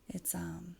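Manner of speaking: hushed breathy whisper. Real, not acted. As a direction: drop to a whisper. Sad hushed moment.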